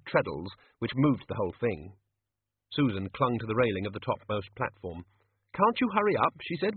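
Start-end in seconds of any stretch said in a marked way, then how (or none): 5.6-6.3 high, pressing complaint; impatient call in a higher voice. A narrator's reading. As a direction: urge them to hurry, pitched well up. Impatient Susan urging the pedaller on.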